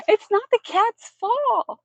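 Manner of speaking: high slow squeaky voice; cat voice. Pretend. Voicing sympathy for cat.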